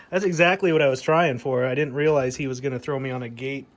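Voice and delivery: excited laugh. Real, not acted. Hears his echo on radio.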